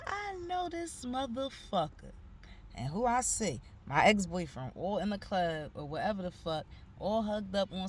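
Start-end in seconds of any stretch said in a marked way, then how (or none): 0.0-2.1 disbelief. Retold or imitated. Recognizes her ex.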